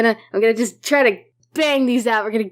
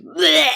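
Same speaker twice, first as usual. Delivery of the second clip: imitated retch into the mic; badly faked puking noise; mock vomiting sound; 5 semitones higher than usual. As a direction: fake a short, wet throw-up noise. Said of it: "could you make a vomiting sound".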